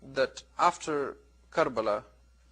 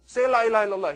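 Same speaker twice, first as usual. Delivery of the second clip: urgent plea; 5 semitones higher, 5 dB louder, much faster than usual. Retold or imitated. Urging dying man again.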